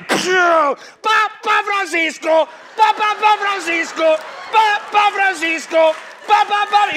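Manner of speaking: gritos agudos de fans; multitud gritando. Acted. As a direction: corea el nombre como fan enloquecido. (público gritando eufórico)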